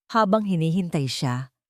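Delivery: ordinary delivery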